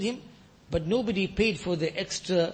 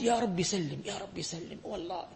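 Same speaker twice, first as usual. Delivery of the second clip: anxious whispered prayer; 9 dB quieter than usual. Retold or imitated. Quoted fearful prayer.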